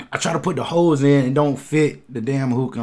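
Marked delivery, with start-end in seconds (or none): none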